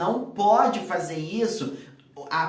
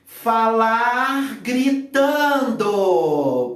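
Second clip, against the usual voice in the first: loud, drawn-out mock shout; demonstrative shouting; 3 semitones higher, 6 dB louder, much slower than usual. Shown as a demonstration. Speaker showing his co-host the mistake of shouting at audiences, demonstrative shout. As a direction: bellow it slowly, stretching out each word. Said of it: "Não grite, por favor".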